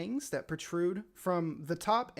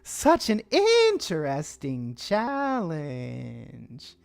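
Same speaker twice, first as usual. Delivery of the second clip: high, very slow, loud insect voices; chorus of bug voices; 6 semitones higher, 11 dB louder, much slower than usual. Acted. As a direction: stretch the words like eager giant ants. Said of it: "four or five different bug voices at once".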